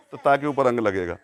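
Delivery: usual speaking voice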